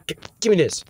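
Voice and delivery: ordinary talk, nothing marked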